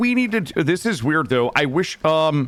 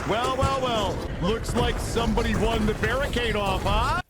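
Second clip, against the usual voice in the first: mock gloating; 5 semitones higher than usual. Gloating after winning.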